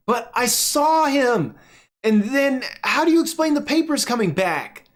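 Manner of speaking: put-on high child voice, pleading; a kid who won't be doubted; insistent little girl. Clara insists to her daddy she saw a merman, high insistent child.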